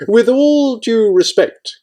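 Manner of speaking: high, loud, slowed indignation; rudely indignant. A narrator's reading. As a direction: hit each word slowly, high and loud. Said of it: "about to get extremely rude".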